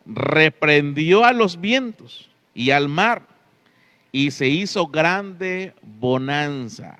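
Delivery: ordinary delivery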